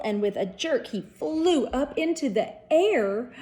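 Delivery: ordinary delivery, nothing marked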